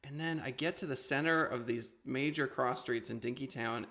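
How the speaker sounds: normal talking voice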